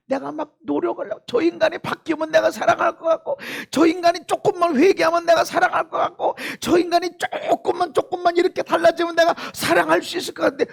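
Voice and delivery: high-pitched, urgent preaching; forceful preaching. Real, not acted. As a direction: argue it out high and hard.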